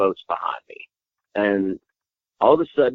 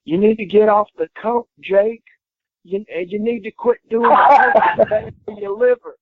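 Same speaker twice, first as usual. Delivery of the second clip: much higher, louder mock tirade; imitated furious rant; 12 semitones higher, 6 dB louder than usual. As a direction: rant like a wrestler losing his mind at a rival. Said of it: "Freight Train lost his mind".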